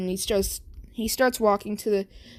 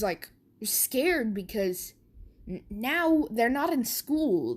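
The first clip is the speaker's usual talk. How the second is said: usual speaking voice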